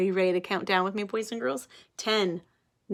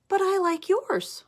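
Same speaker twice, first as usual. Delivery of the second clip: sweet plea; 10 semitones higher than usual. Acted. Child pleads sweetly.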